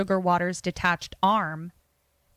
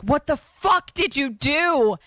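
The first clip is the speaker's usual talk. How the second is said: high, drawn-out mock outrage; hammed-up horrified demand, slow and high; appalled outburst; 7 semitones higher, 3 dB louder than usual. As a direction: stretch it out, aghast at what was done. Acted appalled reaction by [name].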